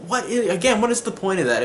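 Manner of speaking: high, incredulous what is the point; exasperated disbelief. Retold or imitated. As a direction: voice jumping up in disbelief. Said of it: "and I'm like".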